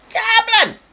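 very high-pitched comic cry; mock exclamation. Comedian interrupts his flight story, high comic cry. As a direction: squawk the city name out, sudden and high.

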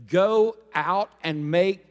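drawn out, slow and loud, waiting for the crowd; slow call for response. Shown as a demonstration. Preacher prompting the congregation to answer, slow call. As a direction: stretch it out and leave the gap. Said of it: "Say it with me".

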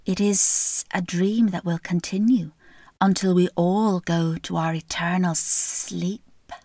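slow, sly snake voice; a lightly voiced, unhurried snake. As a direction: draw out every word like a slithering snake. Snake voiced in fairy tale.

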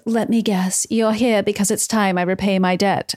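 lightly put-on British accent. Betsy greeting Pietro about her debt, light British accent. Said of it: "speaking in a British accent".

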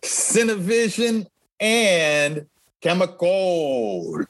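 slow, drawn-out Spanish accent. Shown as a demonstration. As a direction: roll it out slowly in your best Spanish accent. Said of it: "my best Spanish accent".

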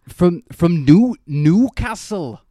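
slow, high, loud Geordie; Geordie accent. Shown as a demonstration. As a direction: chew the words in a Geordie accent. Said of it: "or even, like, a Geordie accent".

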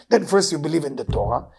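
ordinary talk, nothing marked

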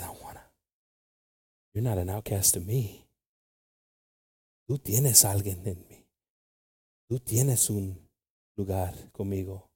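soft, low voice of God comforting; tender reassurance. Acted. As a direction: gently reassure the one cast out, unhurried. Voices God accepting the outcast.

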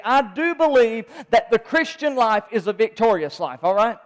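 loud, driving Sunday sermon that keeps building; rousing preacher calling out to the church; full-force preaching. Acted. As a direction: preach it hard and lift the room.

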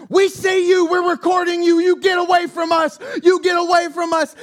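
imitating the couple screaming from their RV. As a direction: yell it like you're filming a threat. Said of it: "they start screaming at me".

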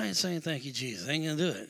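low, quiet mutter; retold sulky protest, kept small; reluctant refusal. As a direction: grumble a refusal under your breath. Husband refusing under his breath.

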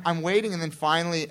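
usual speaking voice

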